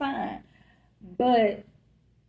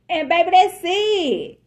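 emphatic exclaim; 8 semitones higher, 9 dB louder than usual. Real, not acted. Emphatic wrap-up to viewers.

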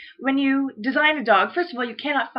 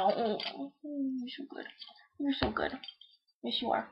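hushed, tender praise for the dog; soft dog praise; 13 dB quieter than usual. Real, not acted. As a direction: murmur praise close to the puppy.

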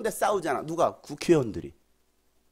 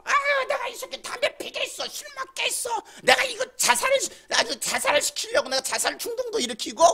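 high, strained demon voice; screechy possessed ranting; scheming demon. Acted. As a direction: squeal out the wicked boasts. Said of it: "그 속에서 귀신이 자살하게 만드는 거야".

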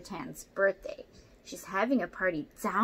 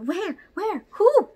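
higher and louder startled questions; alarmed cry; 11 semitones higher, 13 dB louder, slower than usual. Acted. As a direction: ask in sudden alarm. Orangutan alarmed by monster.

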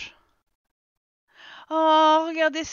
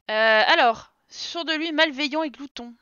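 ordinary talk, nothing marked

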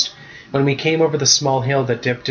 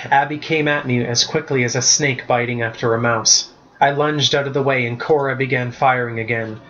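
usual speaking voice

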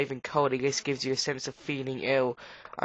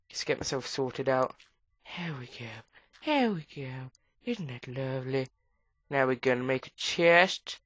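usual speaking voice

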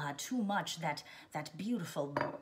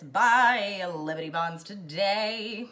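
put-on loud, slow old-timey announcer; slow, loud mock propaganda call; mock wartime announcer; 2 semitones higher, 8 dB louder, much slower than usual. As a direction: pitch the war bonds like a newsreel. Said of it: "this continental accent".

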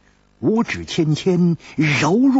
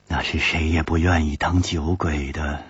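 usual speaking voice

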